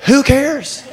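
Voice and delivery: high, exasperated "who cares" with a laugh in it; impatient Jairus. Retold or imitated. Jairus scoffs at Jesus stopping in the crowd, high and laughing. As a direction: toss it off as a frustrated father. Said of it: "Jairus is going".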